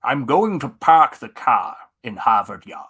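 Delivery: loud, husky Scottish star attempting a Boston accent; hammed-up Scottish-Boston accent mash-up; Sean Connery. Shown as a demonstration. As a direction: impersonate a Scot's clumsy go at Boston speech. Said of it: "Sean Connery doing a Boston accent".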